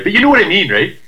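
high, loud Canadian "buddy"; Canadian accent. Pretend. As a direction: play up a cheerful Canadian. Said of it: "your Canadian accent".

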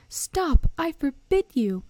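high, loud frantic shout; alarmed outburst, voice pitched right up; panicked yell. A narrator's reading. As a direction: yell a desperate order in panic. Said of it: "yelled Uncle Vernon in panic".

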